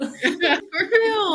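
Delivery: high, loud laughing agreement. Real, not acted. Agreeing with her friend, laughing loudly.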